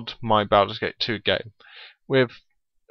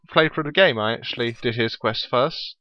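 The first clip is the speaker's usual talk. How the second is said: ordinary talk, nothing marked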